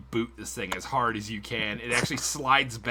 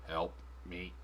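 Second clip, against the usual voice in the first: flat robotic plea with no emotion; monotone voice; 6 semitones lower, 11 dB quieter than usual. Retold or imitated. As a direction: say it dead flat, like a broken machine. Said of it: "in just a super monotone voice".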